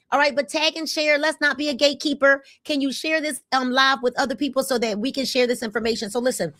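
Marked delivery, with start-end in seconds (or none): none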